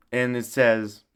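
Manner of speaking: normal talking voice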